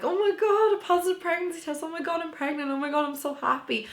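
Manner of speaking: high, gushing, overjoyed squeal; squealing with pregnancy joy; excited. Retold or imitated. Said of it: "I wasn't like".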